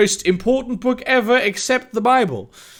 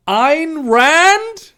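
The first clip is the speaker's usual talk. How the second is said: puzzled; 8 semitones higher, 6 dB louder than usual. Real, not acted. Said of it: "I'm sorry, what?".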